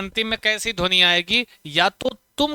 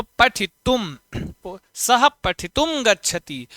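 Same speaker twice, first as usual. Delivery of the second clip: slow model sentence; slower than usual. Shown as a demonstration. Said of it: "teacher models Sanskrit sentence".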